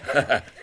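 a loud burst of laughter; short amused laugh. Acted. Inspector laughing at the constable's name.

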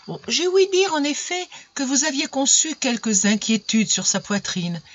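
high, sugary concern; honeyed voice. A narrator's reading. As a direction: coo it falsely sweet. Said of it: "de l'air doucereux".

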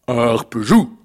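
short, firm giant's order; commanding giant. Acted. The giant orders his golden harp, commanding voice. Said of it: "commanda le géant".

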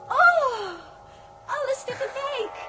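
high, loud gasp into laughter; overwhelmed with delight, laughing it out; overjoyed. Acted. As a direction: squeal of joy at the whole feast. Whole feast appears at once.

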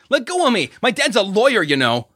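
high, loud struggling shout; panicked yelling. A narrator's reading. Jason struggling against the big guy, high panicked shout. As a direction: shout it while being held.

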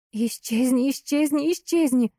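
high, desperate shriek. Acted. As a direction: shriek at him to vanish. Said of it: "Это тоже был мой голос, мой визг".

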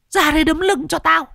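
very high eerie spirit demanding; "Ra đây đấm lưng" ordered in a high ghostly voice; ghost giving orders. Acted. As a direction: ghost orders the girl to pound its back, shrill. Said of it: "nó còn vừa ra lệnh".

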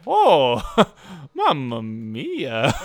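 hammed-up Italian plumber; Italian accent. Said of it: "Mario's like".

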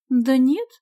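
put-on high, loud girl's correction; objecting. A narrator's reading. Sonya explaining it's her real name, objecting. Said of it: "возразила она".

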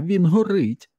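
very high, urgent cry; screamed alarm. A narrator's reading. Woman alerting the men to the burning dog, screamed alarm. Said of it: "кричала вона".

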